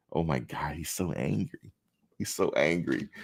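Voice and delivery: hushed, mocking whisper-gossip. Mimicking people who call him angry, hushed and mocking. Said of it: "I come off as what they say".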